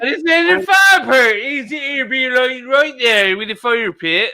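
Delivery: high, unintelligible West Country gabble; thick West Country mock accent, words run together; garbled English accent. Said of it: "That was not English".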